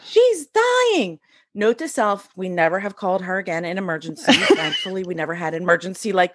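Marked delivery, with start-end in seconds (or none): 0.0-1.2 hysterical high-pitched mock scream; imitated screaming. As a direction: act out a panicking best friend shrieking. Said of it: "my best friend starts screaming".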